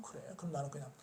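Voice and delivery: whispered, resigned to herself. Retold or imitated. Woman gives up inside herself, whispered. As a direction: breathe it out as a private thought.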